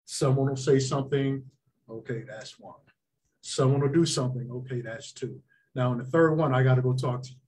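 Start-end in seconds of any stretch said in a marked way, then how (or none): 1.9-2.8 much softer, lower aside; note it under your breath; quiet tallying. Retold or imitated. Referee counting strikes to himself, quietly noting. 4.5-5.4 soft, low note to self; quiet tallying. Retold or imitated. Referee counting second strike, quietly noting.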